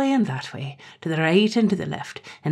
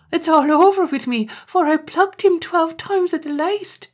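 despairing wail; 12 semitones higher, 4 dB louder than usual. Acted. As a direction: wail it like a man undone. Terence panics over his father.

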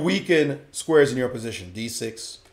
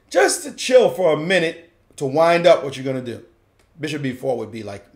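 ordinary talk

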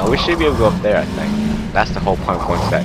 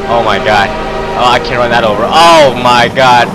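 loud panicked exclaiming; 3 semitones higher, 9 dB louder than usual. Real, not acted. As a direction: panic about the train, loudly. Car stuck by a train.

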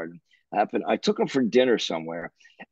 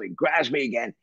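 ordinary talk, nothing marked

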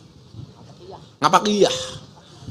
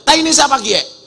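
higher, louder yell in ngapak; ngapak man yelling; 9 semitones higher, 5 dB louder than usual. Retold or imitated. Ngapak man stepping in dung yells, higher and louder. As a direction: call out in disgust after stepping in it. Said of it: "Orang ngapak teriak".

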